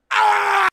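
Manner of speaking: sudden high, loud yell of annoyance; frustrated scream. Real, not acted. Streamer yelling at a chat troll, real frustrated scream.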